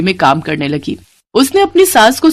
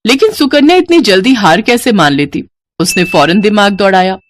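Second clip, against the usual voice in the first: ordinary delivery, nothing marked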